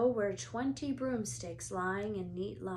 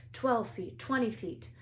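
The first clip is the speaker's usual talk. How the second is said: plain reading voice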